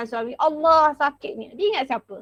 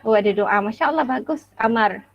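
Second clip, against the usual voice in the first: ordinary delivery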